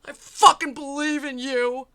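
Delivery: hammed-up tearful yell, slow and high; wailing parody of the line; mock crying shout. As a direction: bawl it like a parody. Mocking tearful boyfriend line.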